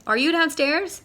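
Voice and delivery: high, loud question; calling out. Acted. Owl calling down to his other self, loud high call. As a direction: call it down the stairwell. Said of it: "he cried".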